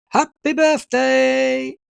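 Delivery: slow, loud, high call from outside; shouting through the letterbox. A narrator's reading. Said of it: "Dylan shouted".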